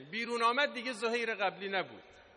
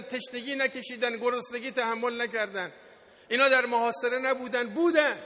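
loud, raised rhetorical delivery in driven lists; full-force preaching; 4 semitones higher, 4 dB louder than usual. Real, not acted. Preacher lists the hardships the martyrs endured, full-force preaching. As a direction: preach it forcefully, climaxing high and loud on the last word.

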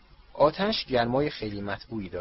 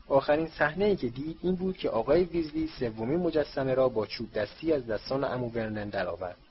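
usual speaking voice

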